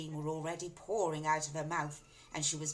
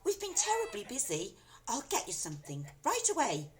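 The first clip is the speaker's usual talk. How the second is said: higher, flustered apology; high apologetic daughter; 8 semitones higher than usual. A narrator's reading. Daughter apologizing to her shouting mother. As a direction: say sorry quickly and nervously. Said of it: "Missus Cranky said".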